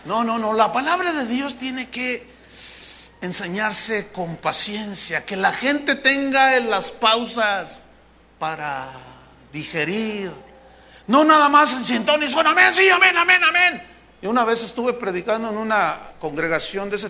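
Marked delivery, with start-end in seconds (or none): 3.2-10.3 slow, measured, with pauses; calm teaching pace; patient slow delivery. Shown as a demonstration. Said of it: "demonstrates patient pacing". 12.3-13.8 loud, higher hammed-up amens; imitating a shouting congregation; mock shouted amens.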